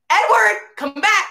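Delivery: high-pitched exasperated call; mock mad. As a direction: call him back, playing annoyed. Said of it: "So I'm like, mad".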